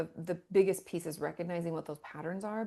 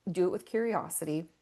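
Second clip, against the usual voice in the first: normal talking voice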